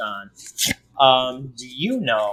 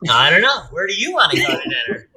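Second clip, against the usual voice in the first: mock married-couple retort, pitched up; bickering spouse; 6 semitones higher, 4 dB louder, faster than usual. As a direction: throw the question back, high and louder at first. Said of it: "like we've been married for a while".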